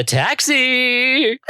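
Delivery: high, drawn-out call for a cab; hailing a taxi. Acted. Sarah calls out slowly and high to hail the ghost taxi.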